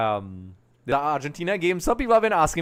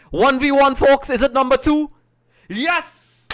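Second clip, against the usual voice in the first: loud, high-pitched live shout; excited goal call; 11 semitones higher, 10 dB louder, slower than usual. Real, not acted. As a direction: shout it as the ball goes in.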